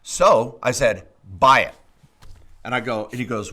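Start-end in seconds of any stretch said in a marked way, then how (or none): 1.4-1.7 louder, punchy two-word command; emphatic order. Retold or imitated. Telling the friend to buy the hay, loud and decisive. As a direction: land it like a decision. Retold decisive text reply.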